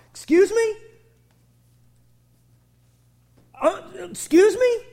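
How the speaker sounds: loud, high, incredulous excuse me; indignant disbelief. Real, not acted. Preacher reacting in loud disbelief to the slogan. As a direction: challenge the slogan, shocked and high. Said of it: "preacher's incredulous retort".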